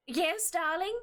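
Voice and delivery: high-pitched, jolly little man; jolly gnome. Acted. Gnomish husband answers his partner, jolly voice. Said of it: "a very just jolly little gnomish man".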